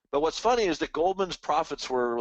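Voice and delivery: normal talking voice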